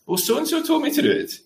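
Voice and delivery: put-on high, soft voice of a child; child's excuse. Child answering a parent after trouble at school, high-voiced excuse. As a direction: pass the blame in a small, high voice. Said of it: "they come home from school and say".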